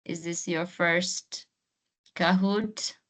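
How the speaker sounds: usual speaking voice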